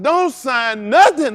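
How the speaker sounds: slow, loud, drawn-out warning; pleading with folks not to sign, slow and loud; urgent warning. Retold or imitated. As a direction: stretch every word to stop them signing.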